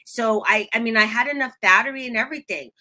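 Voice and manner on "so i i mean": normal talking voice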